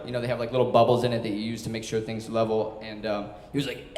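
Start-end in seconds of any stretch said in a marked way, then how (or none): none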